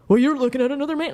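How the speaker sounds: high, quick, mocking imitation of the boyfriend. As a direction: toss it off fast and high, like a smug jab. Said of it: "and he's like".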